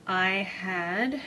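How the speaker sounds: a little winded, slow; out of breath. Real, not acted. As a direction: talk while catching breath. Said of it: "so I'm a bit out of breath".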